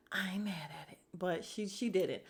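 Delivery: whispered aside. Real, not acted. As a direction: drop to a secret whisper. Aside to viewers.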